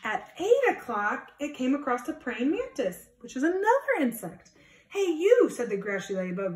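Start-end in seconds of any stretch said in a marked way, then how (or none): 4.9-5.5 high, loud hey you; challenging call. Acted. Grouchy ladybug calling out a bigger animal, loud and high. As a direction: call out to pick a fight. Grouchy bug picks a fight.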